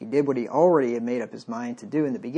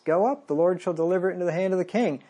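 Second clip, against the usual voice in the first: quick, higher-pitched mock prophecy; 6 semitones higher, 3 dB louder, faster than usual. Prophet mimicking the false prophets to the king, mockingly. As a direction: rattle it off in a raised, sarcastic sing-out. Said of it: "And then he mockingly says".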